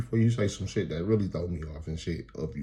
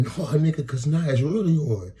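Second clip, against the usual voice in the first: imitating angry woman; 8 semitones higher, 5 dB louder than usual. Acts out woman's escalating threat.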